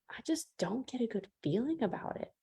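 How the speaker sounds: unvoiced hushed whisper. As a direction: whisper the doubt to yourself. Private doubtful reaction.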